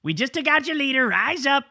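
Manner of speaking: high, hammed-up rallying call; mock a big-talking president urging a revolt; mocking Trump. Host mocks Trump's post urging Iranians to rise up, hammed-up high voice. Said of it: "Trump goes out there on, on Lie Social and says".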